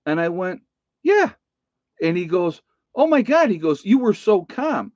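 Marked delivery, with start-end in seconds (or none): 1.0-1.3 squeaky high yeah. Retold or imitated. Retold answer to Eric. 3.0-4.9 amazed. Retold or imitated. Quoting amazed Eric.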